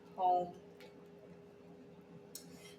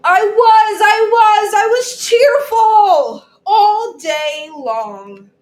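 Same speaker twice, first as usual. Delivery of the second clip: much higher and louder, slow sing-song cheer ending in a laugh; cheerful sing-song; 10 semitones higher, 12 dB louder, slower than usual. Acted. Teacher voicing Bella for the class, loud high cheerful sing-song. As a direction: belt it out like the happiest kid all day.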